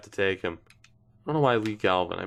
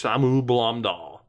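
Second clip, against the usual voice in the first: normal talking voice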